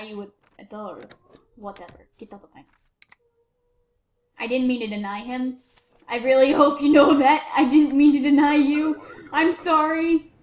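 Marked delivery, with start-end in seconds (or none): 6.1-10.3 calls out a loud, earnest sorry; loud sincere apology to the man he denied. Real, not acted. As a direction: shout the apology after him. Apologising loudly to denied entrant.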